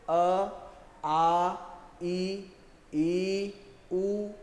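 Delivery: अ, आ, ई, ई, उ each said separately with equal force; vowels demonstrated. Shown as a demonstration. Teacher demonstrating vowel sounds to students, even and clear. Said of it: "मैंने कुछ स्वरों को उच्चारण करके ही बताया".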